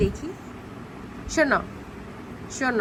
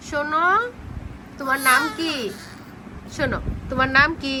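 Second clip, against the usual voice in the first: ordinary talk, nothing marked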